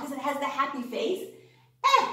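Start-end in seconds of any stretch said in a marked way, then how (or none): 1.8-2.1 "Eh" like the start of elephant; short e sound. Shown as a demonstration. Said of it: "as in elephant".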